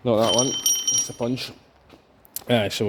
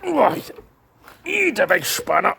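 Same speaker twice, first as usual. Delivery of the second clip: much higher mock-tough voice; 9 semitones higher, slower than usual. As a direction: growl it like an action hero.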